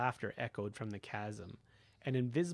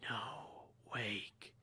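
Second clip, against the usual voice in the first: overlapping graveyard whispers; 7 dB quieter than usual. Acted. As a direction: ghostly whisper of the dead. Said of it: "The spirits of the dead whispered".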